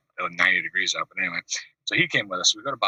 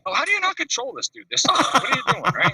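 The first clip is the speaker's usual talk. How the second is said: high, loud mock outrage; hammed-up high-pitched exasperation; disgusted disbelief; 14 semitones higher, 3 dB louder than usual. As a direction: squeal it in horrified disbelief. Narrator's disgusted outburst.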